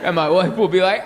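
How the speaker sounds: ordinary talk, nothing marked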